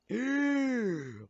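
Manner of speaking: sudden high gasp; sharp high gasp. Acted. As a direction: gasp in shock.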